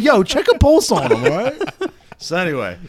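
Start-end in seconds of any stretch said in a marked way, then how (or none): 0.0-1.6 loud joking call. Retold or imitated. Retells joking at sleeping coworker.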